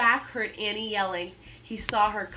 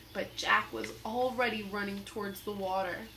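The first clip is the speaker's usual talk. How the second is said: ordinary delivery, nothing marked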